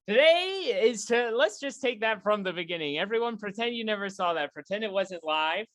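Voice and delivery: ordinary talk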